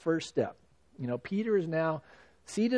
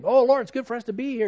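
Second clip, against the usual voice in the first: higher, louder and fast, gushing with delight; eager exclamation; 6 semitones higher, 5 dB louder, faster than usual. Retold or imitated. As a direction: blurt it out like an overeager disciple. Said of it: "Peter's saying".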